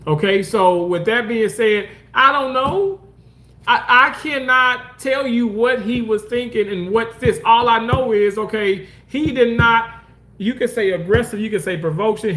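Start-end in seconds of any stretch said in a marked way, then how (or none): none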